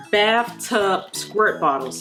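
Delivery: ordinary delivery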